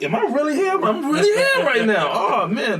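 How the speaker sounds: marvel, laughing, that you're really there; giddy disbelief. Retold or imitated. Teacher acting out his amazement on the Ellen stage, giddy.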